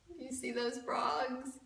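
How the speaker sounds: usual speaking voice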